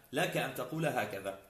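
ordinary delivery

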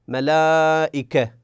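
ملائكة with the aa before the hamza held long; slow recitation-style stretch; long madd. Shown as a demonstration. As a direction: hold the alef in ملائكة, then the hamza. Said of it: "في الألف نقول".

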